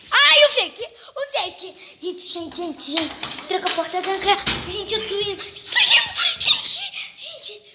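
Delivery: frantic high scream, half laughing; panicked screaming. Real, not acted. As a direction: shriek in real panic. Monster appears, she panics.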